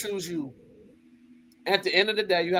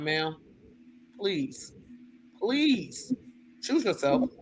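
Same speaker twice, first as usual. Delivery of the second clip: much softer, gentle plea; soft pleading; 7 dB quieter, much slower than usual. Retold or imitated. A counselor begging a suicidal girl to choose herself, soft plea.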